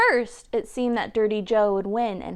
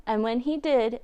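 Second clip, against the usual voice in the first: ordinary delivery, nothing marked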